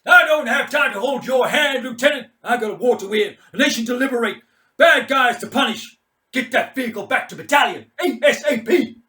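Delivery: loud, high shouted proclamation; grandstanding commander. Acted. Mad Mike declaring his war to everyone, loud grandstanding shout. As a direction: proclaim it to the sky. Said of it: "announces to the darkening air".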